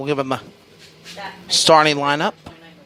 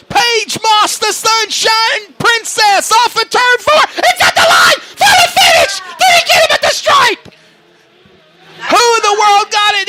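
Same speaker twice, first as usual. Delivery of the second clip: frantic, high-pitched shouted play-by-play; screaming finish call; 14 semitones higher, 5 dB louder than usual. Real, not acted. Photo finish race call.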